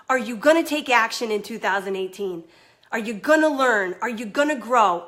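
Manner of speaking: ordinary delivery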